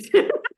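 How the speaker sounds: short high, loud laugh; high, loud amused laugh. Real, not acted. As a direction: burst out laughing. Laughs at own joke.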